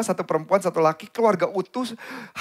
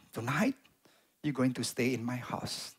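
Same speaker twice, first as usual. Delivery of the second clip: soft, hushed offer; quiet invitation; 5 semitones lower, 6 dB quieter, slower than usual. Retold or imitated. Mother offering the homeless boy a bed, softly. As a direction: tell him gently he stays tonight.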